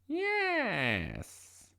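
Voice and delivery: high, drawn-out witch's yes; storybook witch calling in welcome; witch crying out. A narrator's reading. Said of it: "cried the witch".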